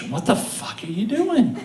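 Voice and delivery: high, stunned exclamation; a wife catching him at something bizarre; mock disbelief from the wife; shocked wife. Wife finds him combing carpet.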